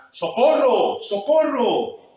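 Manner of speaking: loud, high, dragged-out cry; terrified cry for help. Acted. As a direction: scream for help in terror. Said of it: "pudo gritar".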